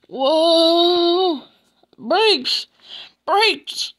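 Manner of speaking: very loud, high cries; alarmed yell. Acted. Oliver yelling to the brake van, at full voice. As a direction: holler for the brakes, high and urgent. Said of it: "Oliver yelled to the brake van".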